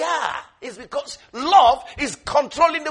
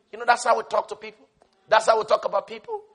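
normal talking voice

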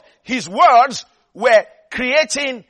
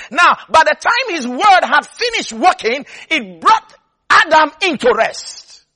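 louder, emphatic preaching with a rhythmic build; rising sermon fervor, louder; 4 semitones higher, 5 dB louder than usual. Acted. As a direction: drive it home from the pulpit. Preacher builds to full force.

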